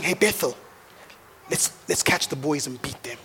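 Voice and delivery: put-on low-key plotting to catch the boys; a hush of mischief; conspiratorial dad.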